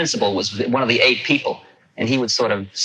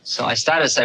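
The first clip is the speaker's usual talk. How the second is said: ordinary talk, nothing marked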